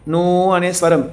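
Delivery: నూ drawn out slowly, with the ఊ held long; slow vowel demo. Shown as a demonstration. Teacher isolating the long vowel for pupils, slowly. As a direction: linger on నూ so pupils hear the long ఊ. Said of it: "second స్వరం వస్తుంది".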